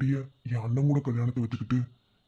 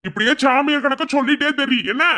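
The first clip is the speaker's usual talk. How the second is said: loud, much higher angry yell; furious shout; 11 semitones higher, 8 dB louder than usual. Acted. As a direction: blast him for his stubbornness. Brother yells in frustration. (கோபத்தில் உரத்த கத்தல்)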